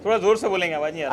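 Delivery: loud call across the room. Real, not acted. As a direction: call out to someone you can't hear. दूर के पत्रकार को पुकारना. (ज़ोर से पुकारते हुए)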